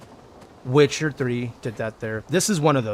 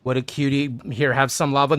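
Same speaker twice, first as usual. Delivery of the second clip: ordinary delivery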